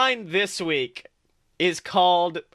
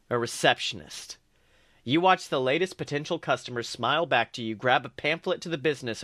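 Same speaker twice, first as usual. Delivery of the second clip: lower, calm fanfic reading; a lightly lower storytelling read; story narration; 3 semitones lower than usual. Acted. Reads fanfic aloud.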